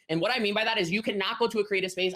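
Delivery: ordinary delivery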